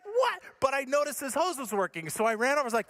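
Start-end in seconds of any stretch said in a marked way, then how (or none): 0.0-0.4 high, short incredulous cry; mock shocked disbelief. Storyteller sees neighbor fall off fence, high mock disbelief.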